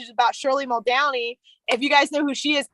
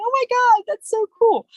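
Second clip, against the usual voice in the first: excited; 10 semitones higher, 4 dB louder than usual. Retold or imitated. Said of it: "And I was like".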